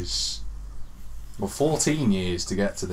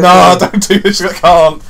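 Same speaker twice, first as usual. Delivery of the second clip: higher, louder, begging in despair; loud desperate plea; 7 semitones higher, 14 dB louder than usual. Retold or imitated. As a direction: beg like you're tearing your hair out. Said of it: "pulling my hair out, going".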